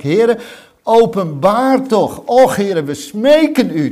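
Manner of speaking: loud, high pleading prayer; fervent shouted prayer to the Lord; cried-out plea. Acted. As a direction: cry it out to God, begging.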